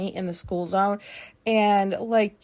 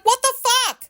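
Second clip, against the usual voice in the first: much higher, louder shocked yell; startled outburst; 14 semitones higher, 6 dB louder than usual. Retold or imitated. Nearly hit by a speeding car, shocked yell. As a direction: shriek it as the Jeep nearly hits you. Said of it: "And I'm like".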